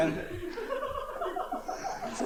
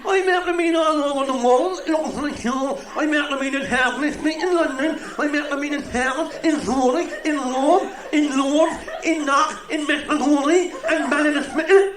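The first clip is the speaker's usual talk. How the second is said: stammering man; 8 semitones higher than usual. Acted. Stammering man lists his travels.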